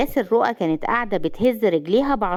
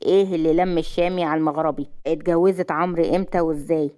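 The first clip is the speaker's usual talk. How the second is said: ordinary delivery, nothing marked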